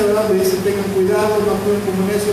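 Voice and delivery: ordinary talk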